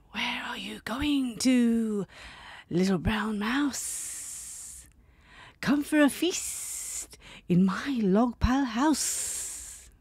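slow whispery snake hiss. Acted. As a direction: draw it out like a sneaky snake. Snake voiced.

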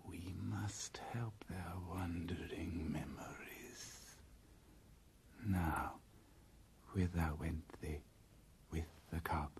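low unvoiced hiss of a python; whispering snake. Python Kaa presses the bear and panther, whispered. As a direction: breathe it out like a scheming snake.

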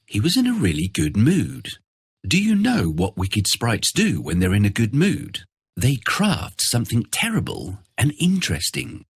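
plain reading voice

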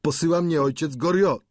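high-pitched servant's reply. A narrator's reading. Servant answering his mistress, high-pitched voice.

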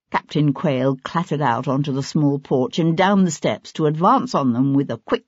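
ordinary delivery, nothing marked

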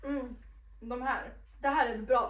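murmuring between bites; chewing while talking. Real, not acted. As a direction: mouth partly full. Seen 0: Pocky stick brought to mouth repeatedly; hand at mouth during words.

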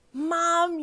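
high, loud call through the house; screaming for mum. Acted. Girl bursting in the door, calling for her mum. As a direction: shout it the moment you're inside. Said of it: "you screamed".

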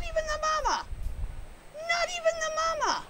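high, outraged squeak; high indignant squeak about the cubs; indignant squeal. Real, not acted. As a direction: squeak in disbelief at the babies.

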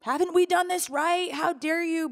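angry protest. Retold or imitated. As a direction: demand an answer, wounded and furious. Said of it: "That was like my".

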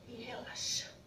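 soft whisper, letting go; whispered relax cue. Real, not acted. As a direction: breathe the word out softly. Instructor cueing relaxation.

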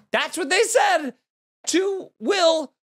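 very high, slow, stretched-out disbelief; incredulous outrage. Retold or imitated. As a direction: squeal it slowly, word by word, appalled. Outraged at what the staff said.

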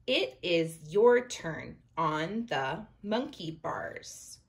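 slow, careful read-aloud; reading to children. Acted. Teacher reading the picture book aloud to her class, slow. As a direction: read it slowly for young readers.

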